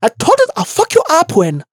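put-on high-pitched angry Xhosa accent; Mihlali. Correcting how she said it while fighting, angry Xhosa accent. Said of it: "No, no, no, no".

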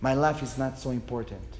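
soft, low, halting apology; a husband's farewell to his wife, understated tears; quiet broken-voiced goodbye to his wife and children; mild retold crying. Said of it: "I was crying like, like a baby".

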